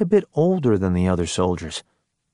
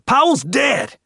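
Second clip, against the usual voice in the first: high, sharp shout; exasperated, high-pitched yell; shouted outburst; 4 dB louder, slower than usual. A narrator's reading. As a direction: cut in with a too-loud shout. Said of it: "Tex shouted, too loudly".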